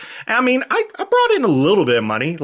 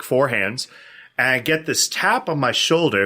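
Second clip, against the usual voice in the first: ordinary talk, nothing marked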